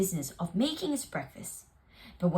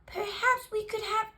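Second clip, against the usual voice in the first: high, polite little mouse; small hopeful mouse asking sweetly; 12 semitones higher than usual. Acted. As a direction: mouse proposes tea to the bear. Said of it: "said the mouse".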